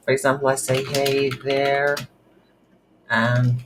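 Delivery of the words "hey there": ordinary talk, nothing marked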